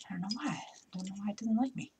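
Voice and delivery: whispering to herself in frustration; whispered aside. Real, not acted. Crafter puzzled by a stubborn bow, whispering. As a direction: drop to a private whisper. Bow won't cooperate, self-talk.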